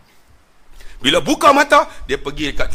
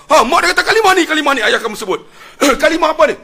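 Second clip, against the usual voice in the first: much higher, louder burst with a laugh; excited mother; 12 semitones higher, 8 dB louder, faster than usual. Retold or imitated. Mother realising the creed from her dream, excited. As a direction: exclaim in sudden recognition.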